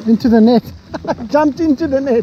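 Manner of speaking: high, loud, delighted disbelief; excited. Real, not acted. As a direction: yell it as the fish lands. Fish jumps into landing net.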